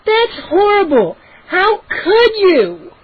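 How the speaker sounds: very high, slow, wailing little sister voice; accusing girl. Acted. Little sister wailing accusations at Joe, very high and slow. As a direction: wail out the betrayal.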